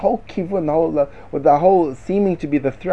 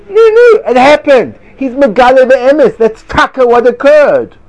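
mock protest; 8 semitones higher, 10 dB louder, slower than usual. Mock arguing the other side.

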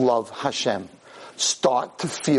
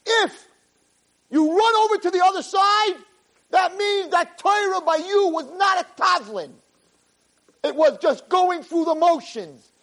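fiery preaching. Acted. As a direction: preach it with full fire. Rabbi preaches at full force.

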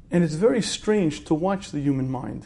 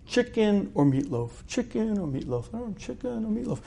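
higher, softening voice of someone weighing options; acted indecision, higher and softer; dithering; 6 semitones higher, 6 dB quieter than usual. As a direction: mull it over, trailing ever quieter. Acted woman dithering over supper.

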